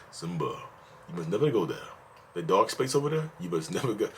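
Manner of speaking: a hint of a grave lion king father; Mufasa. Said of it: "I sound like Mufasa then".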